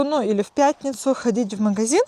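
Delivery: ordinary talk, nothing marked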